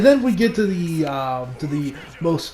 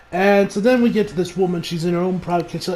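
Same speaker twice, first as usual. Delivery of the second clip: ordinary delivery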